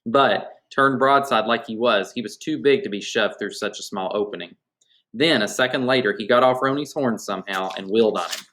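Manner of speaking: ordinary delivery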